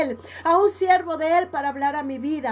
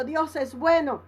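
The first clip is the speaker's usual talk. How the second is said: ordinary delivery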